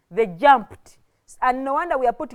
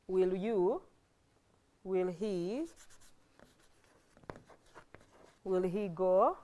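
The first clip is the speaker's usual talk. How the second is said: low, soft, piecemeal dictation; dictating while writing; 4 semitones lower, 9 dB quieter than usual. Real, not acted. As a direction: say it to yourself in fragments as you write. Writing the sentence out while saying it.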